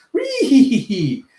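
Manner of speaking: high, loud drawn-out squealing giggle; mock giggling cackle. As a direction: cackle like a jeering minion. Acting out jeering minions.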